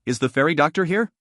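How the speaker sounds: ordinary delivery, nothing marked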